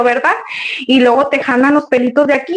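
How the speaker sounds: usual speaking voice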